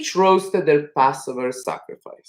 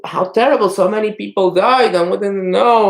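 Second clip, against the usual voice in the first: high-pitched acted wail of grief; storyteller's imitation of weeping; mock crying; 6 semitones higher, 3 dB louder than usual. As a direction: lament the news as a tearful host. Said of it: "start crying and crying".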